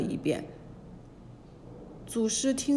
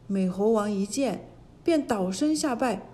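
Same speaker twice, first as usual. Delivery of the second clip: usual speaking voice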